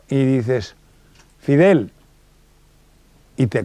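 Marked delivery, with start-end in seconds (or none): 1.4-1.9 calling own name. Shown as a demonstration. Calling his own name aloud.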